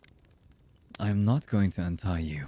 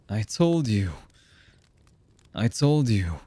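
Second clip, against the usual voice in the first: louder, forceful protest; agitated insistence; 2 semitones higher, 5 dB louder than usual. Acted. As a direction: insist hard, pushing each phrase out. Distrustful captor insists they have changed.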